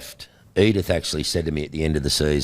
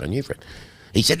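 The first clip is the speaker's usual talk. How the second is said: ordinary delivery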